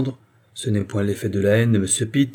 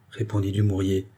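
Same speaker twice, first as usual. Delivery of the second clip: usual speaking voice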